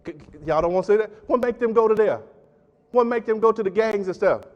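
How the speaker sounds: high, loud fiery preaching; preaching at full force. Acted. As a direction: raise the roof with rhetorical questions.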